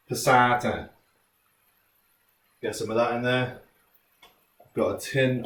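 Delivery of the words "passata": Welsh Cardiff twang on passata; Cardiff accent. Shown as a demonstration. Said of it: "one of my favourite words to say in a Cardiff accent".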